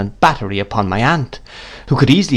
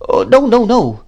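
high, louder string of refusals; dismissive aunt; 12 semitones higher, 5 dB louder than usual. A narrator's reading. Miss Betsy dismisses mother's fears, brisk. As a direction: brush her fears aside emphatically.

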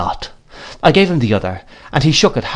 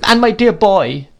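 much higher, slow, tearful; crying out tenderly; 11 semitones higher, 3 dB louder than usual. A narrator's reading. Mother turns to her boy, much higher and tearful. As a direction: cry out to the child. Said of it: "cried my mother".

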